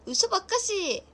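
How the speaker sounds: loud, high-pitched disbelief; disbelieving protest. Real, not acted. Streamer refusing a viewer's correction, in loud disbelief. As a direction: call their bluff loudly.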